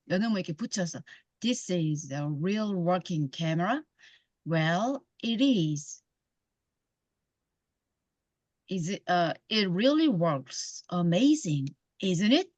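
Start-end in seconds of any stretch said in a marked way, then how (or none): 1.4-12.5 very slow, chunked into phrases; slow phrased reading. Shown as a demonstration. Teacher demonstrating phrasing for learners, in a very slow chunked read. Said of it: "이렇게 끊어서 읽는 게 참 중요합니다".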